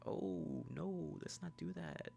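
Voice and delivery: high, soft, slow soothing imitation; Bear. Bear consoles Nikki crying in car, soft high soothing. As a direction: draw it out in gentle mock concern.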